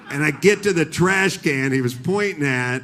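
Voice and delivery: usual speaking voice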